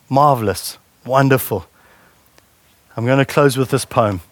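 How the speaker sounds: ordinary delivery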